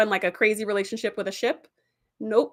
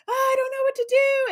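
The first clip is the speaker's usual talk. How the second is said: much higher, helpless panic; panicked Tom. Retold or imitated. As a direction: cry out, lost and torn. Tom torn between two voices.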